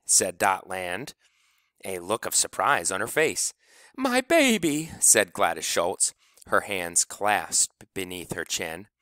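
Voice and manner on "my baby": proud mother. A narrator's reading. Proud mother watching child perform.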